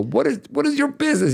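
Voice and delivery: high, quick scolding mom voice; mock mom asking why he meddles; imitating mom. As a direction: toss it off fast and high like a nagging mother. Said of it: "My mom go".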